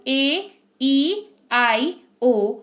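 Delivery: slow, spelled-out vowel letters; vowel names drawn out. Shown as a demonstration. Teacher reciting the vowels for her class, slow and drawn out. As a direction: stretch each vowel name for students. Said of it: "मेरे साथ वापस से बोलो".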